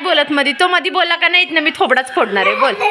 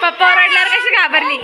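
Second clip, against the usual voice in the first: usual speaking voice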